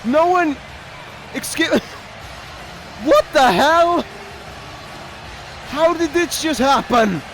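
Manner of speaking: can't get a sentence out for shock; real flustered surprise; flustered disbelief. Real, not acted. Still reeling from surprise entrant.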